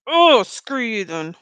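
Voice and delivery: slow, loud "Oh, screw you then"; imitating a fed-up snap back; frustrated outburst. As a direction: throw it out in mock frustration. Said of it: "you get frustrated".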